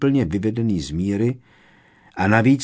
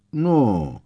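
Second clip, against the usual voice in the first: hesitant, drawn-out word with a raised start; slow, drawn-out; 5 semitones higher, 3 dB louder than usual. A narrator's reading. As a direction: stretch it out as if choosing words. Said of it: "protáhl pomalu Lupin".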